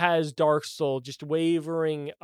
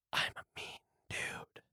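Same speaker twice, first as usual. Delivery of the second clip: hushed golem rasp; raspy whisper; 14 dB quieter than usual. Acted. Golem replying to the scolding player, raspy whisper. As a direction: whisper it back, raspy and unbothered. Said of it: "in the same raspy whisper".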